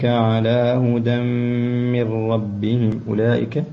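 أولئك with its long vowel held six counts; full elongation. Shown as a demonstration. Teacher showing the obligatory elongation to the student. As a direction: stretch أولئك fully. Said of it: "مد واجب متصل".

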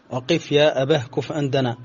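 ordinary talk

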